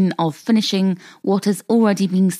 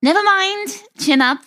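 cheery; 12 semitones higher, 3 dB louder than usual. Acted. Cheering up guest.

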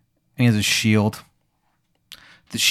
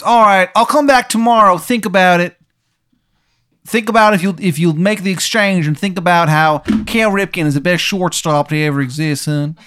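high, loud bad Baltimore accent; bad Maryland accent; 6 semitones higher, 4 dB louder than usual. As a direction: keep the hammed-up Maryland voice going. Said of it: "Accent is just, again".

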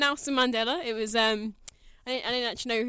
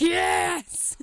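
loud, high mock-epic yes; triumphant cry; 7 semitones higher, 5 dB louder than usual. Co-host imagining walking in with a lightsaber, triumphant cry. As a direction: cry it out like a hero's entrance.

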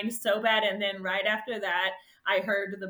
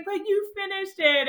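reassuring friend; 10 semitones higher than usual. Retold or imitated. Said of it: "And she's like".

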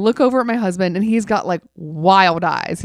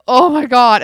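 horrified exclamation; 3 semitones higher, 8 dB louder than usual. Retold or imitated. Horrified at husband vomiting.

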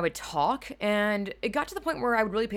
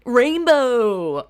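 a loud, higher burst of Rainbow; mock rainbow shout; 5 semitones higher, 12 dB louder than usual. Mocking gamer lights, loud excited Rainbow. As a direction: shout Rainbow like a dazzled kid.

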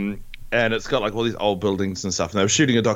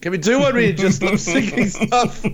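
laughing director; 9 semitones higher than usual. Retold or imitated.

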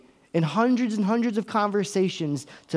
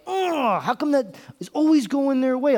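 jealous groan; 9 semitones higher, 4 dB louder than usual. Retold or imitated. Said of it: "I'm like".

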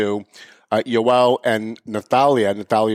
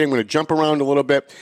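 normal talking voice

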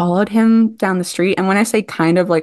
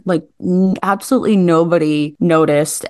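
usual speaking voice

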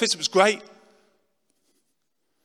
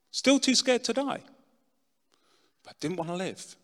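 normal talking voice

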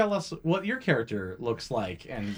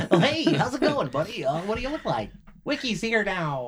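high, swooping, friendly buddy greeting; chummy game character pitched well up; Wiki's character voice; 7 semitones higher than usual. Pretend. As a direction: put on a higher, sing-song character voice. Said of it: "Wiki's voice".

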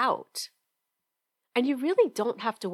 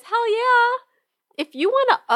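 excited; 7 dB louder than usual. Real, not acted. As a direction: shout it with joy. Emphatic answer to question.

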